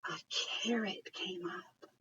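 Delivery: gasping whisper for a big surprise; awed whisper. Acted. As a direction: breathe the reveal as a secret. Gasp, then reveals the carrot.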